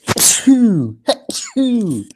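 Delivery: two loud real sneezes; big loud sneezes; sneezing. Real, not acted. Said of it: "I'm going to sneeze now".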